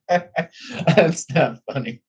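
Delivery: giggling high, slow through the words; trying to stop laughing and failing. Real, not acted. Laughing while insisting to chat it isn't funny. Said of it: "I'm laughing".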